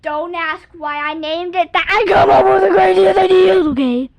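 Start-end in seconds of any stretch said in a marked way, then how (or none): 1.9-4.1 loud exclaim. Real, not acted. Louder exclaiming.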